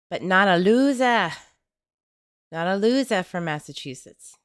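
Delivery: country speaker's hammed-up Boston accent. Host teasing a Massachusetts viewer in a butchered Boston accent. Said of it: "can't even do a Boston accent".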